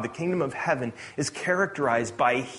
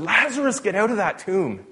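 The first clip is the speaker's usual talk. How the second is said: commanding call; 7 semitones higher, 3 dB louder than usual. Acted. Jesus commands dead Lazarus.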